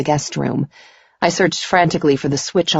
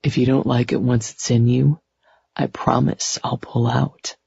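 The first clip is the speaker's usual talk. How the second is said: whispering; 3 semitones lower, 3 dB quieter than usual. A narrator's reading. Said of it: "he whispered".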